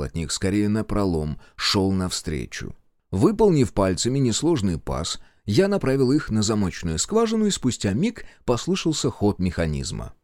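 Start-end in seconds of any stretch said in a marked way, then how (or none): none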